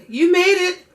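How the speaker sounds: normal talking voice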